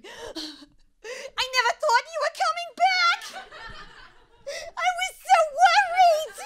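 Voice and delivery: dog greeting owner at the door, high tearful squeal; fragile dog voice. Pretend. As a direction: squeal like a frantic pet. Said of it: "that is how my dog sounds".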